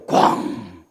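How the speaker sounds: low, booming thud sound; imitated crash. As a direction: imitate a heavy bang that rings out. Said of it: "아파트가 울리더라고". (낮게 울리는 '꽝' 소리 흉내)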